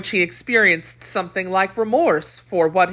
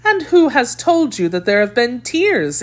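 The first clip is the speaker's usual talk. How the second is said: exclaiming; 7 semitones higher than usual. A narrator's reading. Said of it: "exclaimed she".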